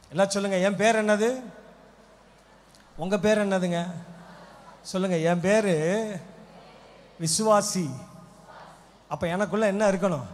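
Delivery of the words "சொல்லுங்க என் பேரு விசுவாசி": normal talking voice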